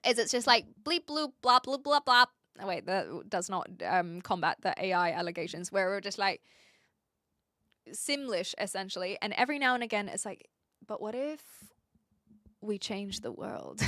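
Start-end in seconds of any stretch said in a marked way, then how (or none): 0.8-2.2 bouncy bleep-bloop gibberish noises; nonsense babble. Retold or imitated. Streamer imitates two hours of nonsense, in bouncy babble. 10.9-13.8 hushed, wondering, with a pause midway; mock profound. Mock profound moment, acted.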